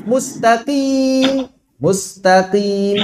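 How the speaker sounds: mustaqim drawn out slowly, with a light qaf in qim; slow, careful model of mustaqim; correct mustaqim. Shown as a demonstration. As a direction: stretch out mustaqim, keeping qim thin. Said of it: "Bukan kim".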